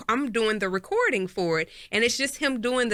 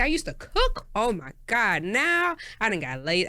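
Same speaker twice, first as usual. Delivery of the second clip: normal talking voice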